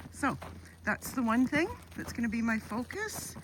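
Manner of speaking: ordinary talk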